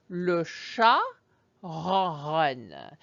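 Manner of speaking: ronronne rolled at the back like purring; le chat ronronne with purring R sounds; purring French R. Shown as a demonstration. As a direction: purr the R's in ronronne. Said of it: "Once more".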